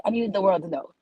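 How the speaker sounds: ordinary talk, nothing marked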